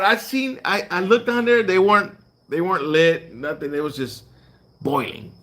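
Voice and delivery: normal talking voice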